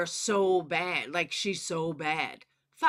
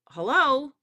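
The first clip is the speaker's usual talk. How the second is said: exasperated hello; 6 semitones higher, 6 dB louder than usual. Real, not acted. Exasperated call-out to critics.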